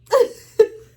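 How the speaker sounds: high, loud cackle; cracking up at her own punchline; loud burst of laughter. Real, not acted. Laughing after telling her cohost the punchline.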